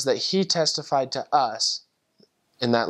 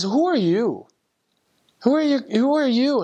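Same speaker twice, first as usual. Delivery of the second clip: imitating overwhelmed pleading, high and rising in intensity; tearful astonishment; 11 semitones higher, 4 dB louder than usual. Tearful convert questioning the missionary, overwhelmed. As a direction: plead in amazement, pitch jumping high. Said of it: "And he cries even more".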